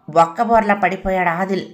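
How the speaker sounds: ordinary delivery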